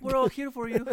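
put-on high, doting Latin mom accent. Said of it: "I don't know how to do a fucking Latin accent".

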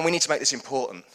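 loud, driving preacher repeat; rousing preaching. Acted. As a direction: press it home to the church. Preaching at full force.

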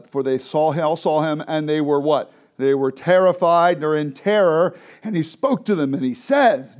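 loud, intense scripture reading; dramatic reading. Acted. Teacher reads the disciples' terror aloud to the class. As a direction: read the terror out strong.

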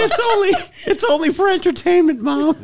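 high, squeaky mock pleading; mock plea to mom. Teasing the guest by begging her mom in a high mock plea. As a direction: pretend to beg mom to understand, hammed-up.

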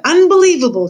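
loud, high cry of disbelief; outraged shout. A narrator's reading. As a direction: shout it out, pitch leaping up. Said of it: "he shouted".

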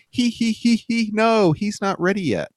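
high, sing-out mock chorus of voices behind someone; lightly mocking, pitched well up, oddly encouraging; weirdly supportive. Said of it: "in a weirdly supportive tone".

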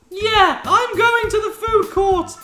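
put-on very high, loud falsetto girl; piercing put-on girl's voice; Hermione voice. Voicing Hermione walking off.

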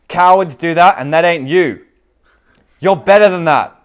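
high, very loud rebuke with belief behind it; impassioned shout. Acted. Father telling his son he is better, impassioned shouting. Father's speech reaches its peak.

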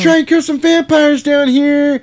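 high, loud, silly put-on voice; squeaky parody of a brave vampire hunter; high mock character voice. Voicing character in cave scene.

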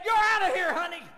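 a sassy deacon's loud send-off; deacon dismissing. Retold or imitated. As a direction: throw her out with a loud jab. Deacon throws visitor out.

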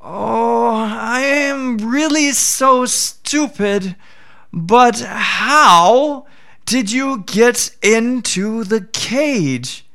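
a crafty jackal pretending to be slow-witted; sly jackal. Jackal voiced in folk tale.

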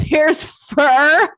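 high, wailing mock cry over the fur; mock despair. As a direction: whine it like a hammed-up breakdown. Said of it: "and you're like".

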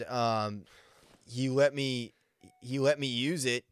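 ordinary talk, nothing marked

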